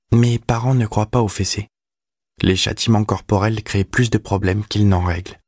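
put-on high boyish voice reciting principles; teenage boy, self-assured. A narrator's reading. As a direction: answer back like a confident schoolboy. (voix aiguë de garçon, sûr de lui)

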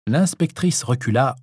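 plain reading voice

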